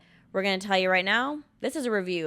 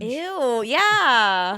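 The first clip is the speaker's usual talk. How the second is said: higher, drawn-out groan of revulsion; mock disgust; 5 semitones higher, 7 dB louder than usual. Friends mocking heartfelt sympathy as cringe, exaggerated disgust. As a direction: recoil loudly from the sincerity.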